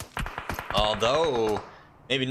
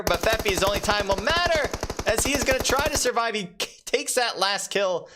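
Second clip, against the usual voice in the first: excited play-by-play; 10 semitones higher than usual. Real, not acted. Caster calling the final fight to viewers, excited. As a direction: ride the action as Feffy survives. Live call of close fight.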